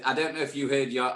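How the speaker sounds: ordinary talk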